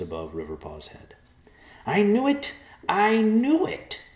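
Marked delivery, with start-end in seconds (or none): 1.9-4.0 high squawking bird voice; shrill old bird crowing; vulture voice. Acted. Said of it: "the vulture said".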